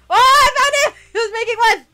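laughing victory yell; excited shout. Real, not acted. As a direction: crow it out with glee. Found the target again.

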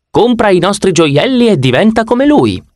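high, glossy commercial announcer; ad voiceover. Pretend. Narrator imagining a boutique ad, in a high glossy announcer voice.

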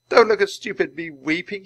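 high, loud, upset outburst; tearful. Acted. Teddy weeps before his friends, tearful and embarrassed. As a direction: protest through acted tears. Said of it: "A tear fell from Teddy's eyes".

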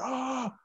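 short high yelp in a hammed-up scare; a sudden high startled "Ah!", half-joking; brief high mock shriek; mock scream of fright. Retold turbulence scream.

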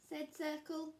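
plain reading voice

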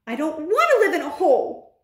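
high, petulant shout; shouted protest. Acted. Bruno to friend suggesting mouse life, petulant shout. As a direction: object loudly, pitched well up. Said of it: "Bruno shouted".

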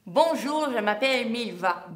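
talking around a pencil; muffled by a pencil between teeth; pencil in mouth. Shown as a demonstration. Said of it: "Avec un crayon. Comme ça".

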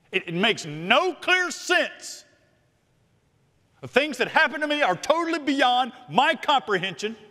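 high, emphatic preaching cry; impassioned preacher at full force; forceful preaching. Real, not acted. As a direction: pitch it up and drive each phrase home.

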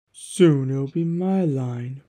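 soft, slow scheming murmur; slow soft secret boast; whispered to himself. A narrator's reading. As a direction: say it quietly to yourself, savouring it. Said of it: "he whispered to himself".

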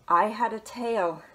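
ordinary delivery, nothing marked

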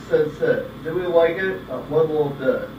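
ordinary delivery

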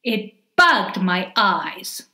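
ordinary delivery

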